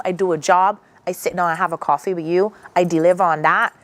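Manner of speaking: ordinary talk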